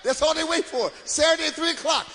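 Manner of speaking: ordinary talk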